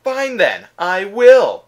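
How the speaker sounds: loud, high, huffy retort; indignant defiance. Acted. Reviewer challenged to review a bad game, loudly defiant. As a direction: raise your voice in defiant indignation. Acted huff at being challenged.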